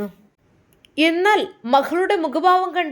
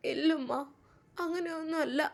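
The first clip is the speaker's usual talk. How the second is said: soft denial; 3 semitones higher, 9 dB quieter than usual. Acted. Daughter denies to scolding mother.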